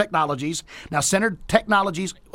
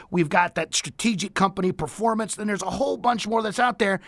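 ordinary talk

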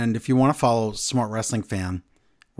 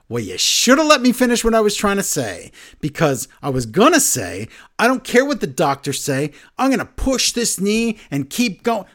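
imitating Rollins; 7 semitones higher, 3 dB louder than usual. Rollins' promo retold.

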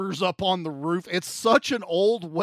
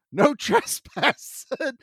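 mock old-lady warning, high and slow; comically scolding old lady; little old lady; 7 semitones higher, slower than usual. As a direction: draw it out high like a little granny. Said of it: "there's this little old lady".

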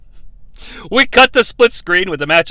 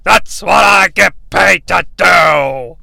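slow, slurred drawl; drunk wrestler; slower than usual. Acted. Wrestler remarking on the teardrop suplex, slow drunken slur. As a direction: drag it out boozily. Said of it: "He's under the influence".

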